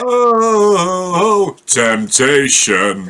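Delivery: long drawn-out "oh", then slow syllables; high swoop sinking into slow, drawn-out mock seduction; mock temptation. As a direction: tease with a hammed-up, stretched delivery.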